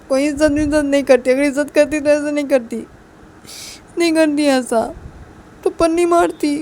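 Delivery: loud, hurt outburst while wiping away tears; acted tearful scolding; tearful reproach. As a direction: throw the accusation back, loud and upset. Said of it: "आंसू को साफ करते करते बोलती".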